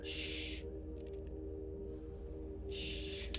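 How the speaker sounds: whispered shh; librarian shushing whisper. Acted. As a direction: hush them with a soft shh. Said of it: "Why are you shush-, shush-, shushing me?".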